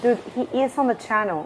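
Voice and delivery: ordinary talk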